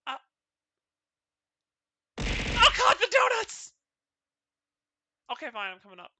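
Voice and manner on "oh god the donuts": high, loud surprised cry; startled gasp. Pretend. Avatar suddenly noticing the donuts, surprised cry. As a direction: gasp as if spotting treasure.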